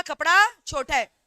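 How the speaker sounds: "कपड़ा छोटा है" said loudly and slowly, with the weight on "कपड़ा"; loud emphatic retort. Retold or imitated. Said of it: "दुकानदार गुस्सा में बोला". ("कपड़ा छोटा है" ज़ोर से, धीरे, "कपड़ा" पर ज़ोर)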